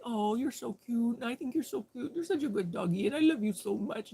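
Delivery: put-on mild baby-talk coo to a dog; cooey voice. Praising the neighbor's dog in a light cooey voice. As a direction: coo sweetly at the doggy. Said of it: "You gotta talk in the cooey voice".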